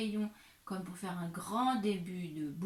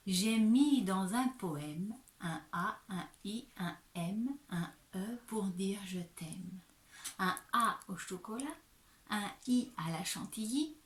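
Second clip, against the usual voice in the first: put-on measured, singsong recital for young pupils; poem recitation. Shown as a demonstration. Teacher recites a Mother's Day poem to pupils, measured recitation. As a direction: recite the verse line by line, with pauses. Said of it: "je te récite le début du poème".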